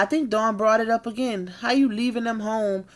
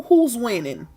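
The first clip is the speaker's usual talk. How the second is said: normal talking voice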